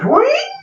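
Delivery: very high cartoon whoosh sound; a rising cartoon transformation whee; Super Friends whoosh. Man changes into a hawk, high cartoon whee. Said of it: "with the Super Friends sound".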